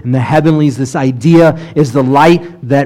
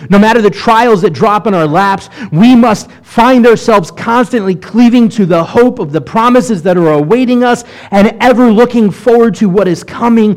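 higher, passionate sermon build; full-force preaching; 5 semitones higher than usual. Acted. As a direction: preach it out to the congregation. Preaching climax on God's promises.

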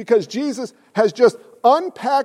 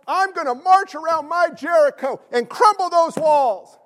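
higher, hammed-up go-getter voice; mock motivational boast; 6 semitones higher than usual. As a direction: puff it up like a self-help slogan.

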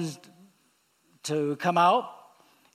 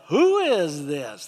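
booming divine challenge; 6 dB louder, slower than usual. Acted. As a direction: thunder it out as God confronting Job. God challenging Job from the storm.

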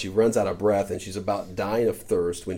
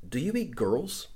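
slow, higher, timid question; frightened girl; 4 semitones higher, 4 dB quieter, slower than usual. A narrator's reading. Jill asks the lion, slow and frightened. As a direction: ask it small and scared, slowly. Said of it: "she said".

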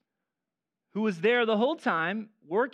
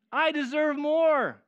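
1 mocking entitlement; 8 semitones higher, 6 dB louder, slower than usual.

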